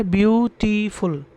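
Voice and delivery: ब्यूटीफुल in three parts, ब्यू, टी, फुल; syllable by syllable. Shown as a demonstration. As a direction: separate each syllable of ब्यूटीफुल. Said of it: "जैसा कि उच्चारण है".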